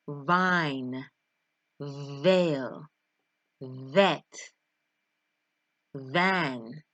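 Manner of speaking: vine, veil, vet, van, devil, drive, each with a strongly buzzed v; careful, isolated words, leaning hard on every v; strong V sound. Shown as a demonstration. Teacher modelling the letter V for learners, strong buzzing v. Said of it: "a very strong V sound".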